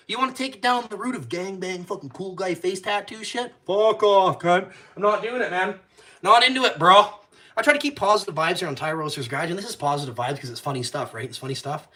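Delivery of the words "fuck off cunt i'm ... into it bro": loud, high snarl of refusal; real angry yelling; angry shout. Real, not acted. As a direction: tell them to get lost, loud. Angry dismissal of gang stuff.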